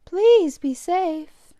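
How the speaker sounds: slow, high, caring mother; mother's voice. Acted. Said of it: "said his mother".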